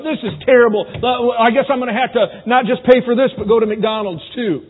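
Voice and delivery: loud, high, mock-harsh grumble; pushy complaint. Husband rudely complains about wife's meal, mock-harsh. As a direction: push the complaint hard and loud. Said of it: "You don't push it like".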